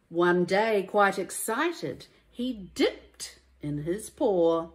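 lively, sing-song rhyming storybook read; reading to children. A narrator's reading. Teacher reading a rhyming picture book to children, lively and sing-song. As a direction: bring each rhyme to life for little listeners.

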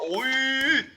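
sudden high yelp; surprised cry. Real, not acted. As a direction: jump at a sudden moment in the match. Sudden exclamation during play.

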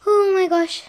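high, thrilled gasp of words; excited. Real, not acted. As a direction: burst out with excitement. The awaited moment is close.

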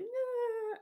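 high, drawn-out nah; mock nah. Kid voices the heroes reacting, high squeaked nah. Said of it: "are like".

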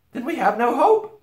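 put-on high, loud dismayed girl; despairing Susan. A narrator's reading. Girl losing hope, high and dismayed.

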